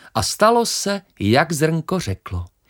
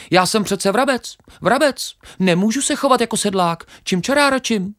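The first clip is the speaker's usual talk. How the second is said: very high, loud, quick chirpy sparrow voice; cheeky sparrow; 9 semitones higher, 5 dB louder, faster than usual. Acted. Sparrow laughs off the grain, very high and cocky.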